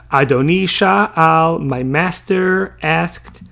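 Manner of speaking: very slow, deliberate reading of the verse. Acted. Teacher starting to read the next verse to the class, very slowly.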